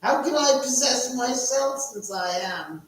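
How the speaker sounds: high, loud cackling ghoul host; Crypt Keeper voice. Pretend. Cackling Crypt Keeper host greeting his viewers. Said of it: "I almost feel like the Crypt Keeper".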